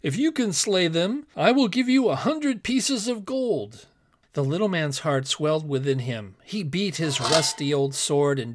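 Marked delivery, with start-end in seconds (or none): 0.0-3.9 higher-pitched, urgent royal plea; impassioned king. A narrator's reading. King offering gold to whoever slays the giants, impassioned. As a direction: plead for a champion with emphatic, raised voice.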